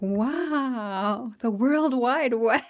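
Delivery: drawn-out, marvelling first-time internet user; playful, stretched-out awe; mock wonder. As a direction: marvel slowly, as if just discovering the internet. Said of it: "like it's my first day on the internet".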